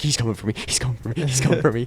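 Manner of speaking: soft, fast frightened repeating; scared mutter. Retold or imitated. Player alone with the ghost, a soft frightened mutter. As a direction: panicky under the breath. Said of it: "and I'm like".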